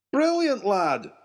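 put-on high, excited old professor; professor's cry. Professor praising the lad, excited high cry. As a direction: burst out in delight at the idea. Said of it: "cried the professor".

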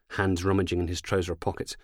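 ordinary delivery

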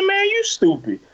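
high-pitched indignant retort, retold; heated comeback. Him answering the other driver, high heated comeback.

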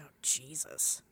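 breathy whispered dismay; exasperated whisper. Retold or imitated. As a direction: whisper it in disbelief under your breath. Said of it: "I'm like".

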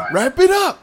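loud, high yell; mock yell to end the announcement; shouted. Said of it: "You should just shout it".

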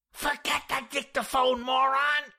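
high, loud, harsh hiss; snapped retort. A narrator's reading. Jack snapping at George in the washroom, angry. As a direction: snap back, hitting the insult harder. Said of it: "I snapped".

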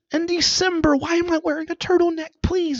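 put-on high pleading kid voice, fast; whiny teen. Kid pleading with mom over the turtleneck. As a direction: beg like a bratty kid. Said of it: "and I'm like".

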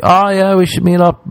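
high-pitched fake-friendly imitation; awkward politeness. Acquaintance ending an awkward talk, high polite pretence. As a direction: lift the voice into insincere social niceness. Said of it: "having an awkward talk".

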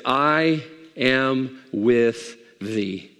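very slow, low-pitched vow; solemn pledge. Acted. Armor bearer pledging himself to Jonathan, solemn. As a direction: weigh out each word of loyalty.

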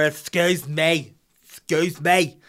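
put-on loud, drawn-out drunken interruption; slurring drunk caller-over; blitzed woman. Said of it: "classic blitzed woman".

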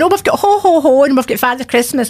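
high, bright chirpy shop assistant; bright, high seasonal sales patter; cheery shopkeeper. Retold or imitated. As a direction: chirp it out like a jolly shop clerk.

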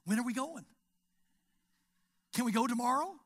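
put-on high, loud eager child's voice; excited kid. Grandkids begging grandpa about the trip, loud and eager.

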